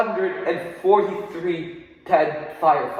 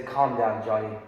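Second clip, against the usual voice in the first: Ray calming me; 9 semitones lower, 8 dB quieter than usual. Retold or imitated. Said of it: "Ray would tell me, he would say".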